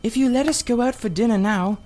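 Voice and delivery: put-on high girlish voice, hushed and careful; a young girl reassuring her friend; Polly, a young girl. A narrator's reading. Polly's voice in the story.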